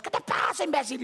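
high-pitched retold confrontation; outraged buddies. Fan's friends confronting the speaker, high angry outburst. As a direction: imitate friends jumping in angrily.

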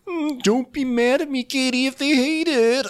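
imitating a chef begging the boss; mock chef plea. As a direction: whine it like a nervous chef. Imitating Matt plating steaks.

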